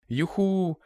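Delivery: squealing hooray. A narrator's reading. Children cheering about the campfire, high squeal of joy. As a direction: kids squeal with delight. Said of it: "zavískly obě děti".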